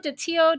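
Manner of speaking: Datillo with a silent L, "dih-TEE-oh"; pronunciation guess. Shown as a demonstration. Trying a chatter's surname, guessing Datillo as "dih-TEE-oh". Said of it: "or is that Datillo".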